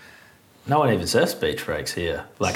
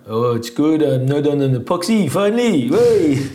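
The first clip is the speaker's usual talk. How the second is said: high, loud pumped quote; excited surfer quote; 8 semitones higher, 8 dB louder than usual. Retold or imitated. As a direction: gush with relief about the board. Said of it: "talking to Michelle".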